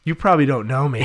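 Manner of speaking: loud, high retold introduction; forward introduction. Friend introducing himself to a stranger, loud and forward. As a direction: blurt it out like an eager acquaintance.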